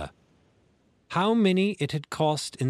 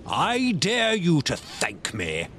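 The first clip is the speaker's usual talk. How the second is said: slow, raised, indignant squire; indignant cry; 5 semitones higher, slower than usual. Acted. Squire refuses Silver's thanks, slow and outraged. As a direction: fling it back at him, outraged. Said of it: "cried the squire".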